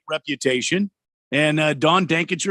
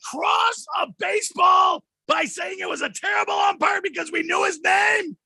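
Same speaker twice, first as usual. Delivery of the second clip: indignant shout; 13 semitones higher than usual. Acted. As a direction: wail it like a wronged preacher. Mock outrage defending umpire.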